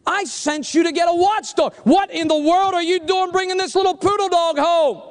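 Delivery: high-pitched, exasperated scolding; hammed-up marital telling-off; scolding wife. Wife scolds husband in joke.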